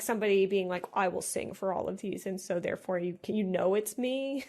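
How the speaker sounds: ordinary talk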